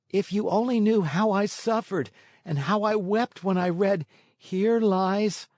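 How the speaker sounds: put-on a boy pouring out how he grieved; high puppet boy voice. A narrator's reading. Puppet boy to his fairy mother, high pleading boy voice. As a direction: lift the voice into a young puppet boy full of remorse.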